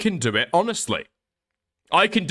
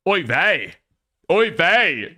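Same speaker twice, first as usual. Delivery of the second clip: mock Queeman; 4 semitones higher, 5 dB louder, much slower than usual. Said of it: "Now I sound like Queeman".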